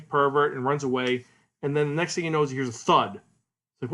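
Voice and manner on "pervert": ordinary talk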